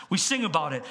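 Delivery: normal talking voice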